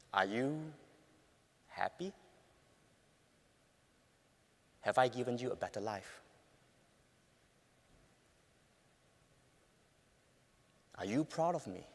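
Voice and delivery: soft, low, vulnerable question; quiet. Retold or imitated. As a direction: ask it softly, nearly whispering. Asking wife quietly at night.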